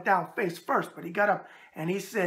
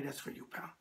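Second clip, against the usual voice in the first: whispered aside; 6 semitones lower, 15 dB quieter than usual. Real, not acted. Aside to a viewer.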